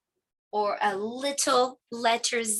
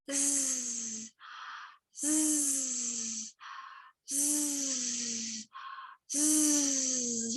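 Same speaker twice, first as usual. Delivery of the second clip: soft sleepy snore buzz; snoring zzz; 8 dB quieter, much slower than usual. Shown as a demonstration. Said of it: "sounds like you're making the letter Z sound".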